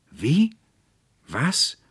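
startled shout. Acted. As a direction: jump out of your skin. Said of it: "rief der Konrektor Paulmann".

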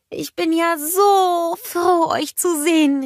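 Anna calling out happily to friends; glad call. A narrator's reading. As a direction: call out in delight at the ice. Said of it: "rief sie".

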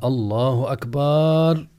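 أكبر drawn out slowly into akbaaar; mistaken stretched vowel. Shown as a demonstration. Teacher imitates someone ignorant opening prayer, long akbaaar. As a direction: hold the last syllable of أكبر long, as "baaar". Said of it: "يمد جهلا، فيقول".

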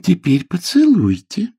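insistent nanny voice; prompting nanny. Acted. Nanny reminds the boy to kiss, prompting. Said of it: "напомнила няня".

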